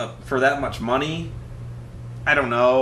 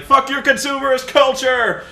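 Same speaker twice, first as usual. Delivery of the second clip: much higher, louder mock outburst; mock rant; 11 semitones higher, 6 dB louder than usual. Killer ranting about consumerism, hammed-up shout. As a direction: sneer it out like a hammed-up rebel yell.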